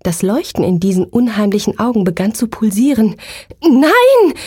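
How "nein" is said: high, loud shriek of no; terrified scream. Acted. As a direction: cry out in sudden terror. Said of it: "Ich schrie auf".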